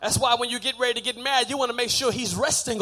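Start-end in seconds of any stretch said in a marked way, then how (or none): none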